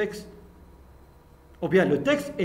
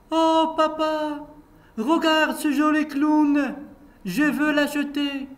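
put-on little girl pleading; 8 semitones higher than usual. Little Amina begging her papa for the clown, higher child voice. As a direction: play the girl begging for the toy.

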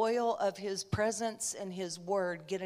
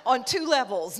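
a loud laugh bursting through the words; loud, high laughing aside; 5 semitones higher, 9 dB louder than usual. Real, not acted. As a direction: crack up while saying it. Laughs at own joke.

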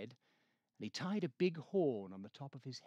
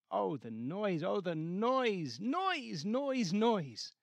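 building higher and louder on each noise; rising exasperation; 6 semitones higher, 4 dB louder, slower than usual. A narrator's reading. Narrator echoing the Grinch's hatred, mounting exasperation. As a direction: pile up the noise in mounting annoyance.

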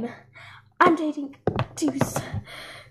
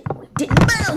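wailing, higher and louder denial; crying yell; 10 semitones higher, 7 dB louder than usual. Acted. Girl losing the quarrel, yelling it with a cry. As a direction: cry it out in protest.

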